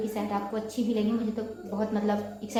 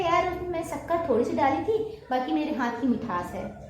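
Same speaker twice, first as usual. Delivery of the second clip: higher, teasing brag about her sweet hand; playful boast; 6 semitones higher than usual. Pretend. To a guest over too-sweet tea, a playful high brag. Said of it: "आप simple सा बोले ना सामने वाले से".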